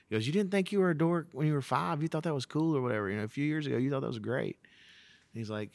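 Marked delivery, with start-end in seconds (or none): 0.3-4.6 lightly higher dad voice, teasing. Retold or imitated. Dad to son, lightly voiced teasing dad. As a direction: gently needle the boy like a dad. [name] voiced in retold cartoon.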